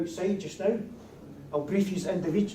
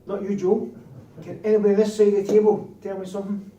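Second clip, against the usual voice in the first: louder, calling across the room; raised voice; 5 semitones higher, 12 dB louder than usual. Real, not acted. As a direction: call it out to the whole table. Teacher calling out to class.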